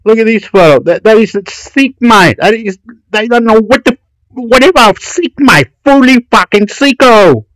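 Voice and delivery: high-pitched, agitated outburst; worked-up ranting. Real, not acted. Ranting at what the photo shows.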